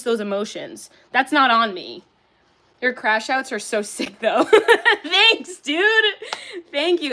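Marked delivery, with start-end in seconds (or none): 4.4-7.1 thanks through a laugh; giggling thanks to a friend. Real, not acted. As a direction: laugh it out, high and loud. Laughing at compliment on crash-outs.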